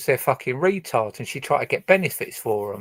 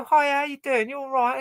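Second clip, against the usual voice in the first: high, sing-song friendly greeting; breezy mock politeness at the door; polite visitor; 13 semitones higher than usual. Polite guest greeting the host, high and cheery. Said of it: "You go".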